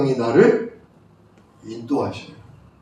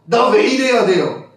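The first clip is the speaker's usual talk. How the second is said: higher-pitched mock complaint; acted irritated plea; 5 semitones higher than usual. Pastor showing how to complain to God, irritated and upset. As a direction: plead upward in mild exasperation. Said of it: "저분께 짜증을 내고 저분께 속상하고".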